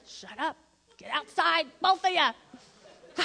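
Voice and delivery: put-on slow laughing command to the kids; laughing scold. Dad ordering the squabbling kids outside, laughing. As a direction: tell them off through laughter. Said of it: "I said".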